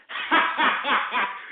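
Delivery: bursts out laughing; a short real laugh; amused laugh. Real, not acted. Laughs at own joke.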